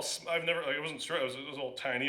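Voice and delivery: ordinary delivery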